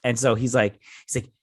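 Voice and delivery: ordinary delivery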